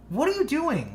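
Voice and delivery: put-on high, loud challenge to a kid; retold scolding. Older brother steps in on kid pushing his brother, scolding.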